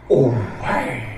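a loud shocked cry; called out. Acted. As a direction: cry it out in alarm. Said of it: "rief er".

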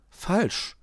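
high-pitched quick comeback; countering. A narrator's reading. Grünäuglein answers Dominik's accusation about spares, countering. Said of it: "konterte Grünäuglein".

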